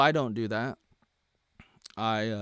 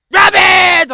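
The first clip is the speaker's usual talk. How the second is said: yelled, much higher and louder; mock shout; 13 semitones higher, 15 dB louder than usual. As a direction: blurt out the word in mock defiance. Shouting a banned word.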